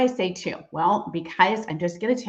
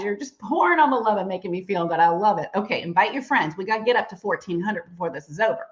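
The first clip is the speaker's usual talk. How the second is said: normal talking voice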